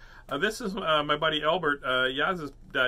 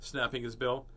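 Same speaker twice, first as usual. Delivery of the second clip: ordinary delivery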